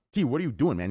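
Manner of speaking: ordinary delivery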